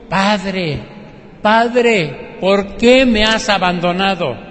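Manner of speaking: loud, pleading cry to the Father, rising on the second Padre; anguished cry. Acted. Jesus on the cross crying out to his Father, anguished and loud. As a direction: call out to heaven in anguish, voice lifting on the second Padre.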